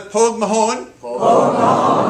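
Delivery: Pogue Mahone loud, "pohg ma-HONE"; Gaelic phrase. Shown as a demonstration. Teacher leading the crowd in Pogue Mahone, loud. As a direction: belt out Pogue Mahone like a cheer. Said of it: "put it together".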